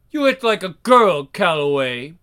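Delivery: plain reading voice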